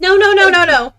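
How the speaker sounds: flustered denial. Acted.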